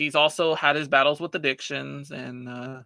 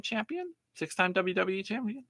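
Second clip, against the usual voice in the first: voice cracking up an octave; 7 semitones higher, 9 dB quieter than usual. Real, not acted. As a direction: voice cracks high mid-phrase. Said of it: "my voice cracked right now".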